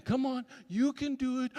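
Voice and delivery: a hint of a parent cooing; encouraging coo. Caregiver cheering the baby, gently cooing. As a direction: softly cheer the little one on. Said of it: "encouraged by the caregivers".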